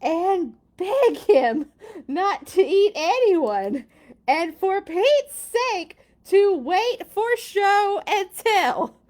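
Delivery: loud, high, slow emphatic begging; pleading narration. A narrator's reading. Reader voicing child begging the alligator, loud slow pleading. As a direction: belt out each word as a desperate plea.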